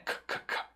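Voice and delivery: bare "K" clicks without voice; voiceless K sound. Shown as a demonstration. Teacher demonstrates the consonant, "K" whispered and voiceless. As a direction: release "K, K, K" softly with no vowel. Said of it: "como ele é não vozeado".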